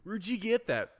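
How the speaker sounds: high, loud stoner question; lightly voiced stoned surfer. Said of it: "Fast Times at Ridgemont High".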